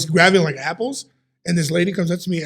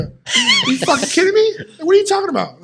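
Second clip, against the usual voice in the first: incredulous; 12 semitones higher than usual. Retold or imitated. Answering the nurse who thinks he's a worker, high incredulous. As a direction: squeak it up high in disbelief. Nurse sends him to drywall line.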